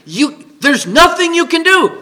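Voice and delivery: ordinary delivery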